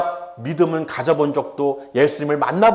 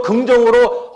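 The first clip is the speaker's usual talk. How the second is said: ordinary talk, nothing marked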